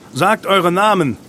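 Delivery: high, loud, slow order; called command. A narrator's reading. Leader demands names in the dark ruin, calling out. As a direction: project a roll call into darkness. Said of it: "rief Losian".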